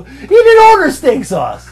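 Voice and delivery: high, loud, drawn-out protest; slow it down and shout it in disbelief; exasperated protest. Retold or imitated. Waiter retelling a steak sauce row, exasperated shout. Retold row with complaining guest.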